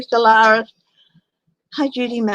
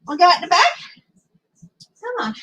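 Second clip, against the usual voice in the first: pet talk; 8 semitones higher, much faster than usual. Real, not acted. Asking the dog.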